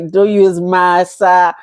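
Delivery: ordinary delivery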